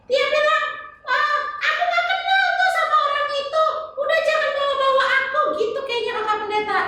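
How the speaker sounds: put-on high, sing-song little girl voice; chirpy kid puppet answering the pastor; high child puppet voice. [name] voicing Peter.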